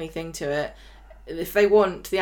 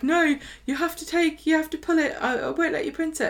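mock panicked plea; 9 semitones higher than usual. Bethany voiced pleading.

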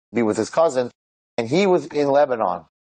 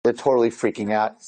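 ordinary talk

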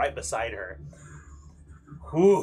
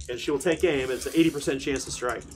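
ordinary delivery

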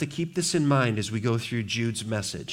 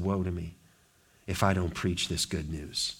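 much softer and lower Woe; quiet grave Woe to me; hushed woe; 7 semitones lower, 7 dB quieter than usual. Acted. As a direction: breathe it low and grave. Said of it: "Paul said".

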